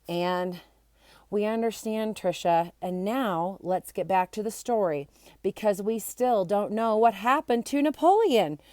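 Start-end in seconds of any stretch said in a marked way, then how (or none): none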